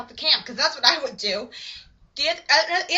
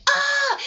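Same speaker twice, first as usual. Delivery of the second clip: high mock yelp of pain; hammed-up "ah" of agony; short acted shriek; pained scream; 13 semitones higher, 4 dB louder than usual.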